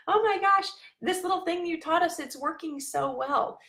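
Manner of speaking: high, loud gush of delight; excited gushing quote. Retold or imitated. Quoting the mom's thrilled message, high and gushing.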